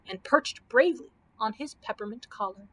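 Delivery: ordinary delivery, nothing marked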